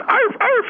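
high yappy arf arf, like a little dog; barking like a dog. Retold or imitated. Father imitating his son barking at the game, high yappy bark. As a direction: bark it out like a puppy. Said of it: "He starts to bark like dog".

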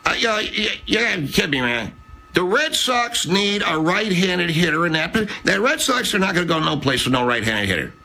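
high, excited mock sports-talk caller; imitating a sports talk caller going on about the Red Sox; sports radio guy impression. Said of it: "It'll just be a couple of guys".

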